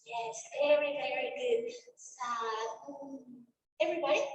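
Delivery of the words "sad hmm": low mock-sad word, soft hum. Shown as a demonstration. Teacher getting children to repeat the emotion, acted sad. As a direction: act downcast with a little hmm.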